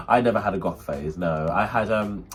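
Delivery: normal talking voice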